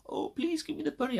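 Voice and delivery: very high, whiny pleading voice; mock begging. Mocking himself begging the buyer, very high whiny plea. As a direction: whimper like a pathetic beggar.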